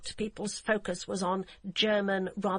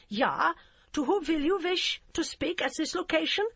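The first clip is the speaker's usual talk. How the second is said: put-on heavy German accent; 9 semitones higher, 3 dB louder than usual. Hamburg policewoman answering the phone in a curt, heavy German accent. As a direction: clip it like a stiff German officer. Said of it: "with an accent so heavy".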